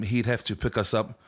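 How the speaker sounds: ordinary delivery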